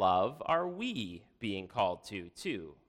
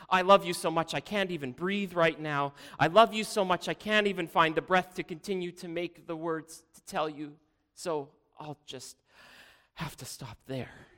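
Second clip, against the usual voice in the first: overcome with devotion; 5 semitones higher, 3 dB louder than usual. A narrator's reading. Daughter too moved to continue her flattery, gushing then faltering. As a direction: gush as if love takes your breath away.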